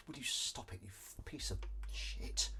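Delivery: annoyed hissed aside; muttered curse. Real, not acted. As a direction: curse under your breath at the speaker. Cursing at device.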